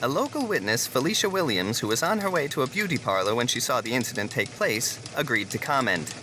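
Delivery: crisp evening news delivery; news anchor read. Acted. Anchor reporting the toilet incident, news read. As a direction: read it like the nightly bulletin.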